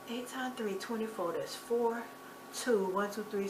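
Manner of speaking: working out sums to herself; muttered counting. Real, not acted. Calculating the loan in her head, muttering.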